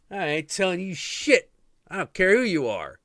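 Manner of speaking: high, loud defiant shout; defiant yell. Retold or imitated. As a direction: yell back at him, refusing. Boy defying Shiva.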